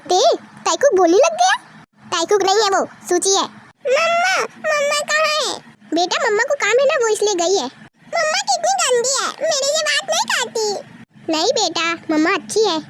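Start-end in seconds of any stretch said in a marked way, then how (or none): 3.9-5.5 put-on high little child asking for mama; a small kid's voice, lifted well up. A child asking dad where mama is, in a high little voice. 8.1-10.7 put-on sulking child. Sulking child complains about mama.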